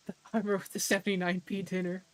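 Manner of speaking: lightly imitating a British character; British guy voice. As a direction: toss off the name in a mock British accent. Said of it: "British guy".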